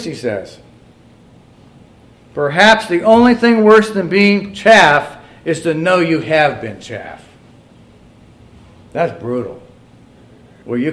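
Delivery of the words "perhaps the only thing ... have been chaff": loud, slow declamation falling at the end; preacher's reading. Acted. Preacher reads the brutal verdict on a wasted life, slow and loud. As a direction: deliver the closing verdict weightily.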